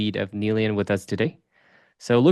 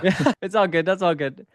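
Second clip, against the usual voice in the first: laughing it off, high and light; 6 semitones higher than usual. Real, not acted. Host laughing off the guest's long intro. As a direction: chuckle through reassurance.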